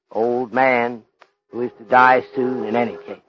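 ordinary talk

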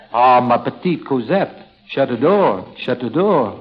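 French lover. Acted. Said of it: "this time you are Pierre Dumont".

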